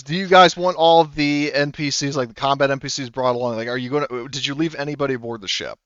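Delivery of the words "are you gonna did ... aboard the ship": ordinary delivery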